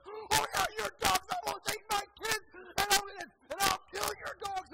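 high, very loud enraged yelling; imitated wife in full pit bull mode; going nuclear. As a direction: scream threats back at the neighbor. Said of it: "goes nuclear on the neighbor".

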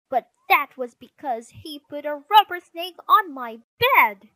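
high, loud indignant retort; squabbling girl's shouted blame; sister arguing back. Acted. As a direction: fire back your excuse loudly. Sister argues back in quarrel.

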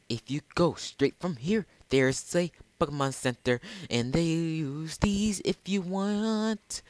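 high-pitched put-on helper voice; helpful game character.

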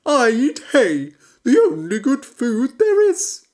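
loud, high proud horse. A narrator's reading. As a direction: praise hay like a grumpy elder. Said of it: "said the horse".

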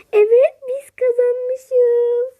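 high, loud and slow shout of joy; excited shout. Acted. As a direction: cry out the win, drawn out. Piyangoyu kazandıklarını fark ediyor. (sevinç çığlığı)

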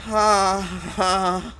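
two loud, high sighs of fright; scared cries. Real, not acted. Gamer after a big scare, shaken cries. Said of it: "that scared the crap out of me".

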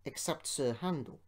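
plain reading voice

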